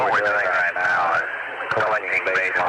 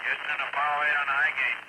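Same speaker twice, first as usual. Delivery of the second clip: fading in through static; 6 semitones higher, 6 dB quieter than usual. Real, not acted. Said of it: "reading you, uh, loud with just a little background noise".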